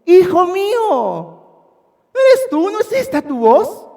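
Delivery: high, drawn-out mock-sweet voice; hypocritical sweetness. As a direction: fake fatherly affection. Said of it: "lo hipócrita que era Saúl".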